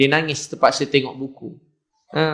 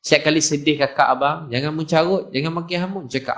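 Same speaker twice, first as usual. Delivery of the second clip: normal talking voice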